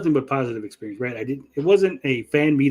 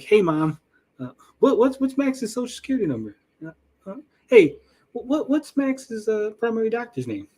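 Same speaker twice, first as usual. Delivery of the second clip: high, halting, embarrassed stammer to mom; flustered phone call; 9 semitones higher than usual. Retold or imitated. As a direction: fumble through it like you don't know the answers.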